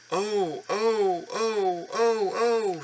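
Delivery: high squealing cries of alarm; screaming trucks. Acted. Trucks squeal as Edward pulls them, high alarmed cries. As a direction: shriek like startled little trucks. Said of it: "screamed the trucks".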